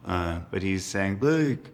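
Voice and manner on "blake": high-pitched imitation of little brother; brother's old voice. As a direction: call out high, as the brother did. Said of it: "old voice, his old voice".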